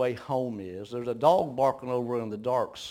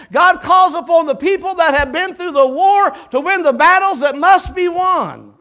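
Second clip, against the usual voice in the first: much higher and louder, driving preacher cadence; preaching at full force; 12 semitones higher, 12 dB louder than usual. Acted. Preacher rousing the congregation about battles, full-force preaching. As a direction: build it into a rousing sermon climb.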